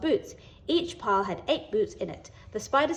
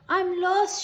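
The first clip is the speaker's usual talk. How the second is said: a high, loud wail; 10 semitones higher, 4 dB louder than usual. A narrator's reading. Lost little spider wailing outside the school, high and loud. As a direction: cry it out in dismay. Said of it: "she wailed".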